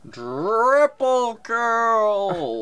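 loud, high, slow announcer shout; arena announcer. Pretend. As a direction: bellow it like a Halo announcer. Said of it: "sounded more like an arena announcer".

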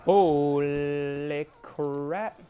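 very drawn-out, loud start, then easing; long, slow exclamation of shock; astonished. Real, not acted. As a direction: stretch each word in amazement. Shocked by what happens in game.

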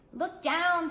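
high, loud excited mouse call. Acted. Red and blue mice calling to the yellow mouse, high and excited. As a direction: call it out in surprise.

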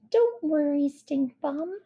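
high, cheerful little character voice; untroubled, chirpy and high. Acted. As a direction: reassure him brightly, pitched well up. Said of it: "she said cheerfully".

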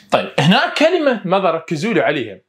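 normal talking voice

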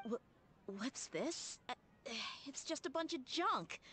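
sudden high-pitched let-down; surprised dismay. Acted. Dia opening her father's box, dismayed. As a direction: pitch jumps up in disbelief.

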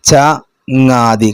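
ചങ്ങാതി drawn out syllable by syllable; slow syllables. Shown as a demonstration. Teacher to class: ചങ്ങാതി sounded out slowly. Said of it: "ഇത് ചേർത്തി വായിക്കാനാ വന്ന്".

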